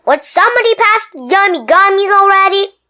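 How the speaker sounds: impatient tooth; greedy tooth. Acted. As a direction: demand the candy. Tooth demanding at movies.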